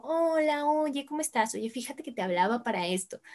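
sweet, accommodating phone voice; answering the phone in her polite hospitality voice. Shown as a demonstration. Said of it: "cuando la estoy usando porque levanto el teléfono".

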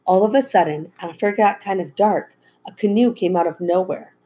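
ordinary delivery, nothing marked

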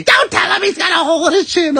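high, fast, loud mock yell; imitated yelling wife. Wife scolding husband about the chin, high yelling. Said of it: "my wife's yelling at me".

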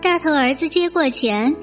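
usual speaking voice

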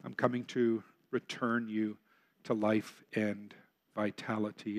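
a lightly voiced, gentle Jesus; reassuring reply. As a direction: answer like a caring shepherd. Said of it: "Jesus will respond by saying".

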